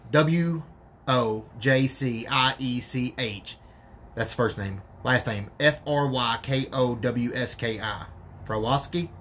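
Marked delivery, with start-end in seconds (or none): 0.1-3.6 W O J C I E C H, slow, letter by letter; spelling it out. Shown as a demonstration. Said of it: "let me spell it out for you". 5.6-8.1 F R Y K O W S K I, slow, letter by letter; spelling it out. Shown as a demonstration. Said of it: "let me spell it out for you".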